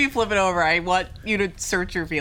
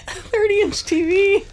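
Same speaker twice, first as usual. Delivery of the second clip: laughing; 12 semitones higher, 3 dB louder than usual. Retold or imitated. Laughing repeat of size guess.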